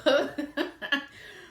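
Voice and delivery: high burst of laughter; loud laugh. Real, not acted. Laughing at his little girl jab, loud laugh. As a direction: crack up out loud.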